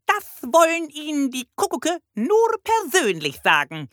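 very high, croaky bird voice; piercing parrot squawk. A narrator's reading. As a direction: report excitedly like a parrot police chief. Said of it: "krächzte der Papagei".

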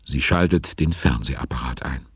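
ordinary delivery

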